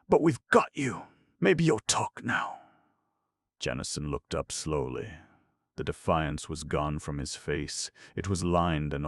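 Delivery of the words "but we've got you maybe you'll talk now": firm detective. Acted. Green presses the suspect.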